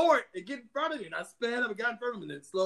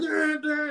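higher, louder honk sounds; horn imitation; 8 semitones higher, 5 dB louder than usual. Driver honking at him, mock horn blasts. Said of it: "he's blowing his horn".

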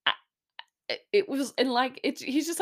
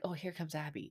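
soft aside; 3 semitones lower, 13 dB quieter than usual. Retold or imitated. Clayton noticing Gabby approaching.

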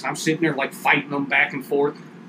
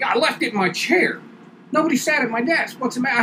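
higher, indignant puzzled reply; defensive confusion; 8 semitones higher than usual. Retold or imitated. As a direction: act baffled at the accusation. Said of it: "And I'm like".

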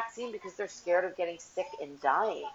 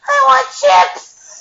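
put-on much higher and louder childish whine; mimicking her child; 15 dB louder, slower than usual. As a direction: echo the kid's demand as a squeaky shout. You're like your cousin.

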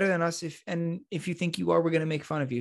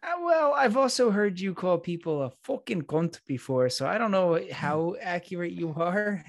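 put-on lilting Irish accent, pitched up at the start; 3 semitones higher than usual. Doubting a friend's claim to correct English, in an Irish accent. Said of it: "That was a good Irish accent".

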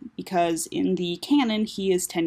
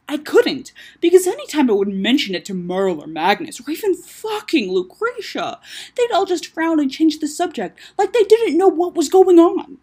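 loud, high-pitched indignant rant; heated defence; 9 semitones higher, 8 dB louder than usual. Acted. Taco defending himself to his sister, worked up. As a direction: argue back, climbing in pitch.

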